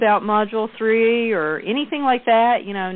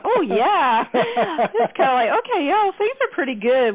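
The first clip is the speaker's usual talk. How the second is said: much higher, bright eager voice; high squeaky reply; 10 semitones higher than usual. Retold or imitated. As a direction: squeak out the happy answer. Playing the answering client.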